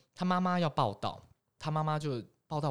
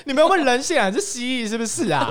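high, loud playful scolding; hammed-up indignant yell; mock outrage; 10 semitones higher, 10 dB louder than usual. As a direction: tease them as cold-blooded lizards. Scolding heartless coworkers, mock outrage.

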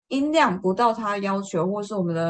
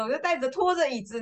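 normal talking voice

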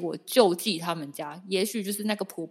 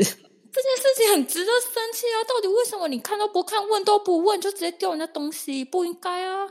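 much higher and louder indignant rant; indignant outburst; 13 semitones higher, 6 dB louder than usual. Real, not acted. Wife venting about her husband tossing the parcel, indignant. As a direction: let the outrage pour out.

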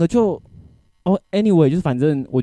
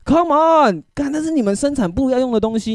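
exasperated come on; 11 semitones higher than usual. Retold or imitated. Ranting to a friend about coworkers, exasperated high outburst. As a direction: throw up your hands in disbelief.

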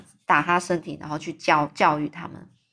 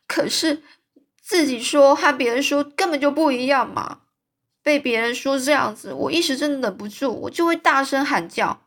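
higher-pitched, pleading protest; tearful; 6 semitones higher, 4 dB louder than usual. A narrator's reading. Anne explains her outburst to Marilla, tearful. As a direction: plead through mild, acted tears. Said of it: "她是含着泪水说".

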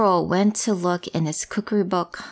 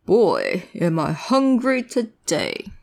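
roaring troll; 2 semitones lower, slower than usual. Acted.

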